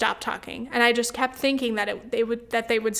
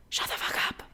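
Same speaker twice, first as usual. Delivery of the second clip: breathy unvoiced whisper, much softer; 8 dB quieter, faster than usual. Daydreaming of telling the couple off, a whisper. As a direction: mouth the insult under your breath. Imagined confronting talkers.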